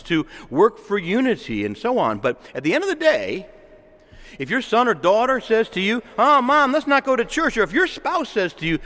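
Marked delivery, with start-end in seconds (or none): 6.2-7.6 put-on higher, louder whiny kid voice; mock child. Preacher voicing a child who begs mom to skip church. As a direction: lightly play a teen begging off church.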